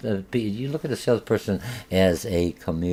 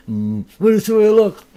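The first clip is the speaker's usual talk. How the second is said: mock grinning salesperson; 13 semitones higher, 9 dB louder than usual. Mocking a bad grin.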